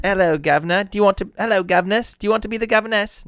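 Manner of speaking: high, loud British caricature; mock British accent. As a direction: ham up a silly British greeting. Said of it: "I got to do my British accent".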